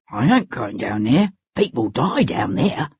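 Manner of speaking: low gruff henchman voice; a scared rough laborer refusing; gruff man. A narrator's reading. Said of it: "a man's gruff voice".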